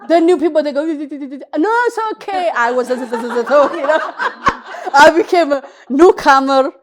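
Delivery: loud laughter through words; laughing. Real, not acted. Recounting becoming a newcomer herself, laughing. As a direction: laugh while telling it.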